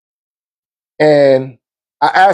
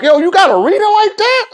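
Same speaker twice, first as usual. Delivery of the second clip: impressed friend; 14 semitones higher than usual. Retold or imitated. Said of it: "And people was like".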